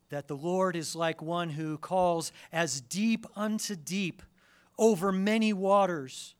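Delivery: high, loud, ringing sermon build; full-force preaching. Acted. Preacher lifting the congregation to the psalm, full-force preaching. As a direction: swell the voice to the pulpit's peak.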